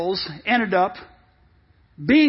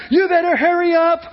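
high, raised hurry-up call; impatient dad; 6 semitones higher, 4 dB louder than usual. Acted. Dad yelling to family running to bathroom, impatient raised voice. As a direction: chivvy the stragglers along.

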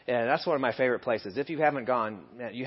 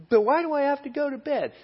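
put-on high-pitched kid protesting bedtime; much higher, put-on kid voice; whining child; 11 semitones higher than usual. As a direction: plead like a child up past bedtime. Voices child questioning a parent.

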